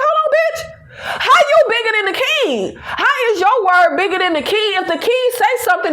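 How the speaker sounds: high, incredulous laughing protest; outraged disbelief. Retold or imitated. Asking how the queen outranks the king, laughing disbelief. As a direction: scoff at the queen's nerve, pitch way up.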